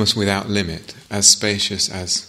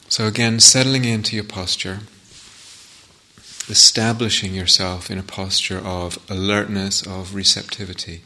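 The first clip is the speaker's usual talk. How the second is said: guided meditation. Acted. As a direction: ease them into the sitting, unhurried.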